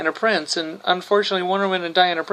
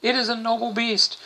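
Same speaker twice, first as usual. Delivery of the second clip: Wonder Woman; 7 semitones higher than usual. Retold or imitated. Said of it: "Wonder Woman's like going".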